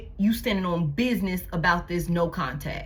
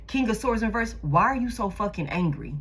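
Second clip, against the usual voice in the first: ordinary talk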